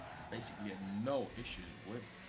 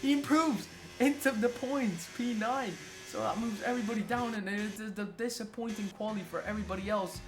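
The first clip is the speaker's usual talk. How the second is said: much higher, louder hyped race commentary; excited sim racing commentator calling the last qualifying laps; live play-by-play; 10 semitones higher, 5 dB louder than usual. Real, not acted. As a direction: call every lap like a finale.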